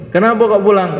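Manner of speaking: high, loud demanding question; elder asking. Acted. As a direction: challenge him on why he's leaving.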